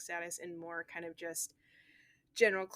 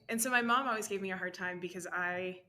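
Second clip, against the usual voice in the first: ordinary talk, nothing marked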